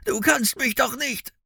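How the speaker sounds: high, strained protest; desperate drowning man. A narrator's reading. Sinking bounty hunter begs Finn, desperate and high. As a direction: cry it high in panic.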